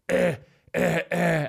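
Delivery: hammed-up drunk eh eh eh; mock drunk protest. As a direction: bark it like an angry drunk. Imitating drunk man's protests.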